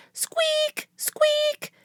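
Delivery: tiny high squeak, breathy then voiced; mouse squeak. Peanut the mouse squeaking at Jack, tiny high squeaks. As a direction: squeak like a scared little mouse.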